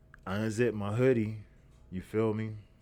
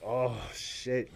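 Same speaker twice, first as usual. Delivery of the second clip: disgusted shock; 4 semitones higher than usual. Retold or imitated. Acting out smelling the shirt.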